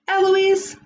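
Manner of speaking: put-on fond call of the girl's name; calling her name. Joe calls her name.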